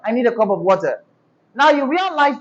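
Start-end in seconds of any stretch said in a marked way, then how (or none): 0.0-1.0 quick, flat Nigerian intonation; flat Nigerian accent. Shown as a demonstration. Teacher showing what not to say, fast flat Nigerian accent. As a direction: run it together evenly in a Nigerian accent. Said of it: "and not".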